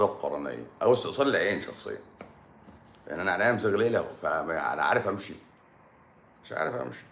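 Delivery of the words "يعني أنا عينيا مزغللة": normal talking voice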